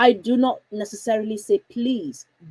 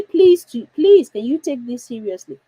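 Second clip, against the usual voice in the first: exaggerated please, easing into the question; pleading; 3 semitones higher, 8 dB louder than usual. Shown as a demonstration. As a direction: plead as if they have a choice. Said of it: "do I even mean it?".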